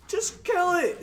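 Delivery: very high, loud laughing plea. Retold or imitated. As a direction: beg him through laughter to finish it. Exasperated at cop.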